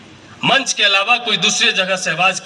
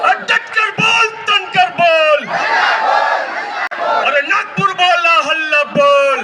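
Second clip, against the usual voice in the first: shouted slogan; 10 semitones higher than usual. Acted. Leader rouses the rally crowd, shouted slogan chant. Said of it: "इतना जोर से हल्ला बोलना होगा".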